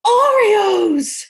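high, crying out the name with a break of joy; delighted surprise. Retold or imitated. Mom discovering the Oreos, overjoyed high shout. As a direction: shout it like a kid who just found the prize. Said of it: "I'm like".

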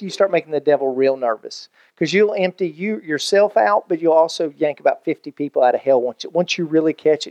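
normal talking voice

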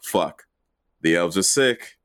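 low, grim Santa voice, speeding up at the end; a gruff Santa resigned to a dirty job. Retold or imitated. Santa grimly realizes elves are sick.

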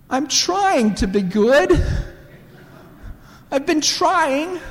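put-on high, drawn-out little-girl plaint; child near tears. Daughter tells babysitter she's trying to be good, near tears. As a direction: imitate an upset child, slow and high. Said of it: "Josephine almost starts to cry".

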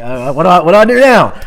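loud, high-pitched flustered mouse; mock panic. Mouse meeting a real Gruffalo, mock panic.

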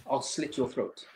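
ordinary talk, nothing marked

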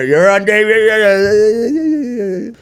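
high, drawn-out mock cheer; excited crowd reaction. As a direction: imitate inmates going wild at the TV. Inmates excitedly react to his video.

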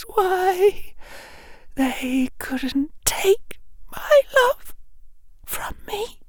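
slow, very high, broken tearful whimper; gasping, quavering sobs. Acted. Bellatrix confessing hopeless love to her lord, weeping. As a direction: sob it softly, halting for shuddering breaths. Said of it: "a long inhalation, like a huge gasp".

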